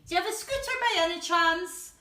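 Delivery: high, loud, fast eager gorilla. Acted. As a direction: ask like an excited gorilla. Gorilla character asks.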